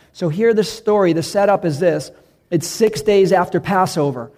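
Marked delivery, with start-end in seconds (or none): none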